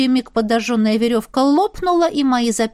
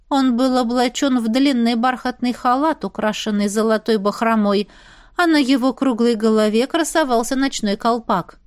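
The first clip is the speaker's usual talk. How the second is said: ordinary delivery, nothing marked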